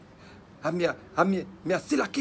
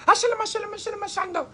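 sudden high-pitched gibberish; resumed fake tongues; 11 semitones higher than usual. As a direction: burst back into showy mock tongues after peeking. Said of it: "go so again".

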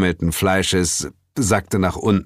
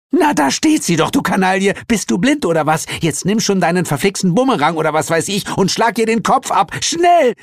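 very high, screeching tirade; rapid, furious cartoon shrew; irate underworld goddess; 13 semitones higher, 3 dB louder than usual. A narrator's reading. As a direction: order the kill in an exasperated high screech.

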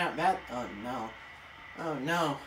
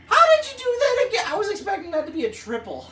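much higher, louder and fast disbelief; astonished; 9 semitones higher, 5 dB louder, faster than usual. Real, not acted. Player stunned by the batter's hit, fast astonished squeal. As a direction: blurt it out in shock.

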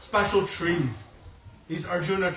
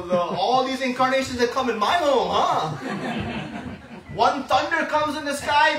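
laughing, mocking disbelief, higher; teasing mother; 7 semitones higher, 4 dB louder than usual. Yashoda laughs at the boy's claim of incarnations, teasing. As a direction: laugh off the child's bragging.

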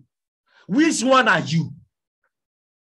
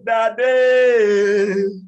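high, loud wailing call; a child's loud cry for Daddy; 10 semitones higher, 9 dB louder, much slower than usual. Acted. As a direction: cry out for your father, pleading. Acted child crying for father.

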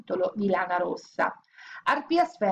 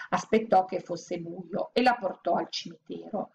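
usual speaking voice

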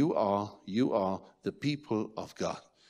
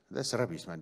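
normal talking voice

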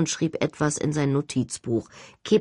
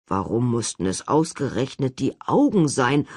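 reproachful; 5 semitones lower than usual. Acted. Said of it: "fragte George vorwurfsvoll".

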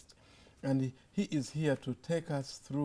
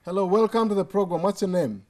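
loud, higher greeting over a bad line; to someone hard of hearing; 6 semitones higher, 9 dB louder than usual. Real, not acted. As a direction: project hard to a faint caller. Caller hard to hear.

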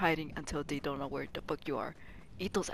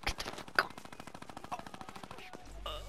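whisper; 12 semitones higher, 4 dB quieter, faster than usual. Real, not acted. Repeats the yell in a whisper.

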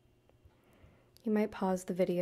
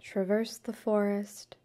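guided meditation. Acted. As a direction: guide the listener gently through breath and forest imagery, unhurried.

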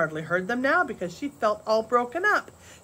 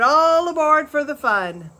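loud, higher rallying shout; Big Jerry calling the men to ride with him; 7 semitones higher, 9 dB louder than usual. A narrator's reading. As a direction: whoop the men into following. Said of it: "he shouted".

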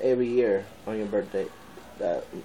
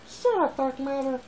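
much higher squeaky dog voice; dog's voice; 11 semitones higher, 4 dB quieter than usual. Pretend. Vlogger voicing his dog telling him off, much higher. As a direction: voice the dog talking back.

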